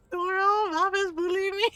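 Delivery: high, loud tattling cry; mock wailing complaint. [name] telling Doro on Mom, loud mock wail. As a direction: call out like a bullied child, hammed up.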